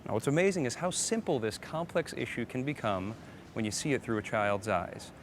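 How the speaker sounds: normal talking voice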